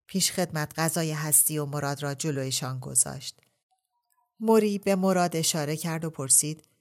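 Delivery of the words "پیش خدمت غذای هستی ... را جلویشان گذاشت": usual speaking voice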